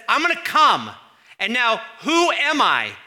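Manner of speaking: preacher voicing God's big announcement to the people; booming God. God's proclamation, belted out high and loud.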